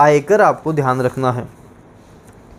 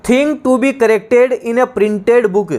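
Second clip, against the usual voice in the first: very slow, higher dictation of the definition; 4 semitones higher, much slower than usual. Shown as a demonstration. Teacher dictating to students, very slow and higher. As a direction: spell the phrase out word by word. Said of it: "dictating definition".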